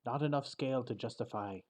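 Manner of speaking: put-on a proud old dragon renaming someone; haughty dragon. Dragon judging the knight's armour, haughty and soft.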